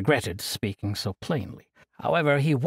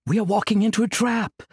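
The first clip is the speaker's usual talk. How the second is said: desperate fear; 8 semitones higher, 4 dB louder than usual. Acted. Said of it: "looking desperately".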